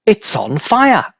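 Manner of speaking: high, slow alarmed cry; grandma yelling at the burning hen. A narrator's reading. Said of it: "Grandma yelled".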